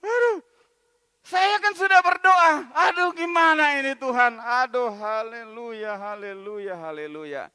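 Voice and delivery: crying out to God, fading into weary hallelujahs; tearful loud complaint fading to a sigh; whining complaint. Acted. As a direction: plead loudly then trail off resigned. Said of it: "Dia mulai bilang".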